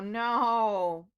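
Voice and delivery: ordinary delivery, nothing marked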